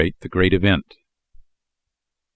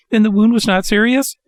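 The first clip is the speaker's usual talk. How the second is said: high eager puppet voice; 12 semitones higher, 4 dB louder than usual. A narrator's reading. Pinocchio relieved, asking.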